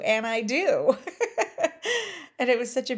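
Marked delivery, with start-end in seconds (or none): 0.0-1.0 breaking into a laugh; words spilling into laughter. Real, not acted. Touched by the lounge guy, laughing as she answers. Delighted by his reply. 1.0-2.3 a high, loud burst of laughter; high delighted laugh. Real, not acted. Moved by his words, laughing out loud.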